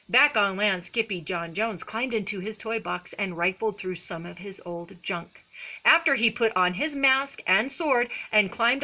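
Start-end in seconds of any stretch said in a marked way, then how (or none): none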